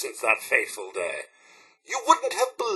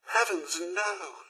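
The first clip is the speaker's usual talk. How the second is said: hushed dismay; 9 dB quieter, slower than usual. Acted. Robot butler hears tragic news from employer, hushed dismay. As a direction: let it fall away quietly.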